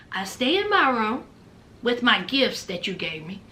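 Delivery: low, softened voice dropping further; quiet firm terms. Retold or imitated. Man setting out the room agreement, quiet and low.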